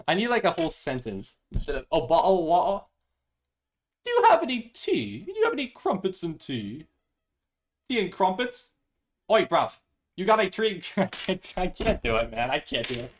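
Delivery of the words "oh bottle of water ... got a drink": hammed-up British accent, higher and louder; mock British accent with a tea-and-crumpets air; cartoonish British accent asking for tea. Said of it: "think of something British to say".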